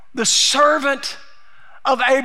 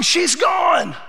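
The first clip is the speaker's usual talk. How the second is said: ordinary talk, nothing marked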